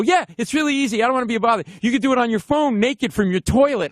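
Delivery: high-pitched, eager selling; over-eager babble. Retold or imitated. Fan oversells podcast to star, high over-eager babble. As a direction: keep pushing the pitch up as you oversell.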